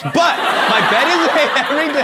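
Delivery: high-pitched mock boasting; mock proud boast. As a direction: brag it loud and high, in jest. Mockingly acting out audience member.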